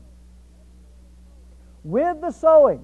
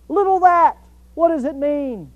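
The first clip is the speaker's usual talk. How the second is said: higher, louder puzzled objection; incredulous question; 4 semitones higher, 4 dB louder than usual. Acted. Listener questions a little Bible word, mildly incredulous. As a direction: play a listener puzzled by one tiny word.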